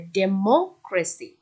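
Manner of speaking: Democracy very slowly, "MO" stressed and held; democracy in slow pieces, third-last syllable weighted; stress third from end. Shown as a demonstration. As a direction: lean on MO in democracy, then "cracy". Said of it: "এখানে স্ট্রেসটা ফেলতে হবে".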